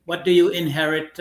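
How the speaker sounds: ordinary delivery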